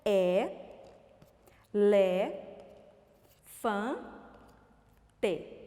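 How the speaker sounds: "É, lé, fã, tê" with long pauses between syllables. Shown as a demonstration. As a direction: cut the word into four separate pieces, "É, lé, fã, tê". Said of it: "Em quantos pedacinhos nós cortamos?".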